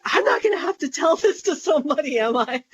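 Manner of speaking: high, fast panicked inner voice with a catch at the end; a retold silent panic, spoken quick and high; freaking out. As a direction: rush it out in rising panic, voice breaking on the tag question. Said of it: "I'm, like, freaking out about that".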